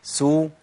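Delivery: سو with rounded u; a short clear سو; sin with damma. Shown as a demonstration. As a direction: round the lips on سو. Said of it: "تحريك السين بالضم".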